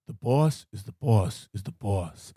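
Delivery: low, slow, weighty mob pronouncement; Stacy Keach. Retold or imitated. Quoting Stacy Keach's mafia wisdom, low and portentous. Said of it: "Stacy Keach goes".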